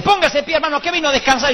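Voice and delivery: high, hyped-up preacher shouting orders; mock electric preacher. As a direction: imitate a hyper worship leader whipping up the crowd. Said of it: "el otro, el eléctrico".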